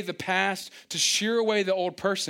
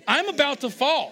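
mock alarm; 9 semitones higher, 6 dB louder than usual. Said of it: "say this to myself".

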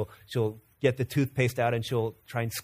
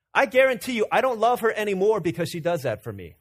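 impassioned preaching; 7 semitones higher, 5 dB louder than usual. Acted. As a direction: drive the point home to the congregation. Preaching point at full force.

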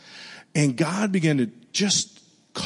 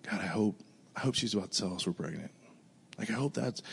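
much softer, almost under the breath; hushed inner prayer; 4 semitones lower, 12 dB quieter, faster than usual. Retold or imitated. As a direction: pray it quietly to yourself, hoping. Silent prayer in his heart.

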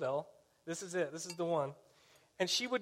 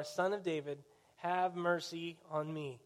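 ordinary talk

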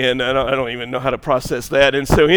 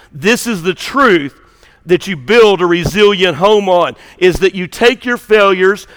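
raised, rhythmic sermon voice; forceful preaching; 5 semitones higher, 7 dB louder than usual. Acted. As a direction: press the truth on the congregation, phrase by phrase. Preaches the main application forcefully.

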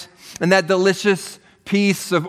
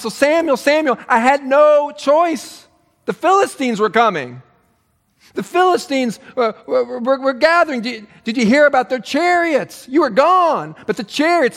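higher, pleading and flustered; a hammed-up whining Saul; whiny excuse-making Saul; 5 semitones higher than usual. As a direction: stammer out excuses like a cornered king.

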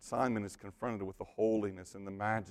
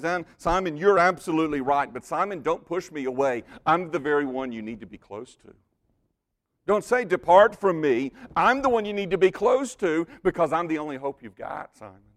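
louder and higher, pressing the plea; urgent pleading; 4 semitones higher, 8 dB louder than usual. Acted. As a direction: plead with him not to leave. Voicing Jesus pleading with Simon.

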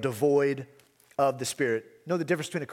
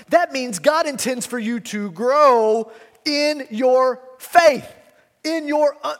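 slow, building to much higher and louder; rising exhortation; 8 semitones higher, 7 dB louder, slower than usual. Acted. Preacher urging the church to grow, climbing to a shout.

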